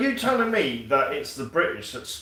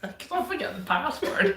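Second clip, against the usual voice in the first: laughing punchline; 12 semitones higher than usual. Real, not acted. Friend joking about a forgotten password, laughing. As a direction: say it through giggles.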